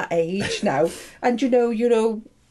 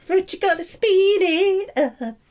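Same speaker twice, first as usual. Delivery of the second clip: ordinary delivery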